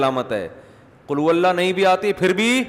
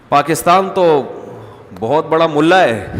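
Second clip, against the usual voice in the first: emphatic preaching; 7 dB louder, slower than usual. Real, not acted. Emphatic point to the audience.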